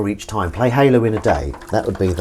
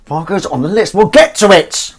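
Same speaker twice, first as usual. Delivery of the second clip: pitched way up and much louder; high, loud sing-song; 10 semitones higher, 8 dB louder than usual. Shown as a demonstration. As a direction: toss it out high and loud, like a cheery promise.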